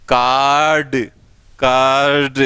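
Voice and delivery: "कार्ड" drawn out on a long aa, r barely heard; long vowel, fading r. Shown as a demonstration. Teacher demonstrating the silent r, "कार्ड" stretched on long aa. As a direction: stretch "कार्ड" slowly and evenly, r melting away. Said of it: "बोलोगे तो ऐसा लगेगा कि it is pronounced".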